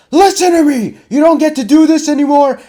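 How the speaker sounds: he's had enough, scream it at him; furious shout. Retold or imitated. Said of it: "he's had enough of my bullshit, and he's like".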